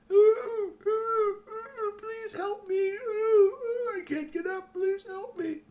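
high, crying whimper of a hurt robot dog; hurt robot dog whimpering for help. A narrator's reading. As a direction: plead in a tiny high whimpering voice.

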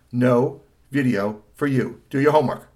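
loud, emphatic parental order; firm mother. Acted. Mother orders son to homework, firm. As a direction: spell out the ban firmly and loudly.

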